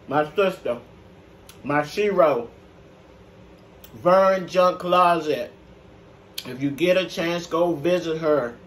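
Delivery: usual speaking voice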